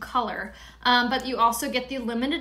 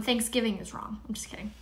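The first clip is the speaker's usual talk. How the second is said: whisper; 7 dB quieter than usual. Real, not acted. Joking aside to viewers.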